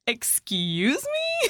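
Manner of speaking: high, drawn-out indignant exclamation; shocked, high-pitched outburst breaking toward laughter; incredulous. Retold or imitated. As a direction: slowly squeal it in offended disbelief. Reacting incredulously to newspaper headline.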